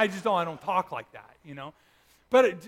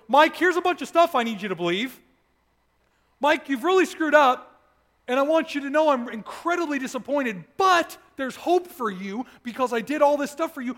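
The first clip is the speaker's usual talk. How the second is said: louder and higher, lecturing like a let-down father; stern disappointed God; 6 semitones higher, 8 dB louder than usual. Pretend. Preacher voices an imagined God scolding Mike, louder and higher. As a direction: scold him from on high, mock-stern. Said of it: "he didn't show up with".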